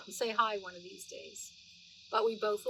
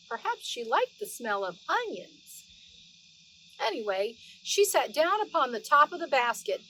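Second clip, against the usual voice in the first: lively read-aloud storytelling voice; story narration; 5 dB louder than usual. Acted. Grandma reading Beatrix Potter to the children, animated storytelling voice. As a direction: read it like a bedtime story for little ones.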